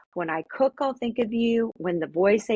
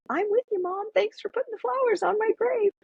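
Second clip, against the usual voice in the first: lightly voiced son, pitched much higher; son's gentle voice; 13 semitones higher than usual. Mother imagining her late son thanking her, lightly voiced. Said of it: "it was like Nate saying".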